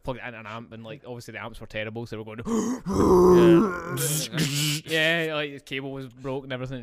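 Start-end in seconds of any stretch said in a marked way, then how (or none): 2.4-4.8 a loud, high, strained gasp standing in for a broken amp; mock amp noise. Retelling the jam to his co-host, a loud high gasp of amp noise. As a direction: voice the crackling amp with one big gasp.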